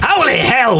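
startled. Acted. Whoopi startled by hand.

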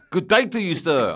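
loud, high mock sign-off in South African; South African accent. Agent ending the call, loud South African accent. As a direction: dismiss him grandly, imitating a South African. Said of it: "I'll do the accent".

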